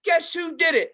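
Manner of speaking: high, loud mock rant about dog mess; ranting phone call. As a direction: imitate the owner ranting on the phone. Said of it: "she says".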